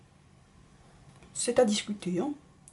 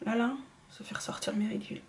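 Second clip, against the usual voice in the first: fast, much softer unvoiced whisper; dismayed whisper; 10 dB quieter, faster than usual. Real, not acted. To viewers, whispering about lines the foundation shows. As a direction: point it out under your breath.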